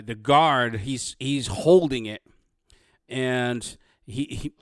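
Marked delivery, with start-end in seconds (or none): none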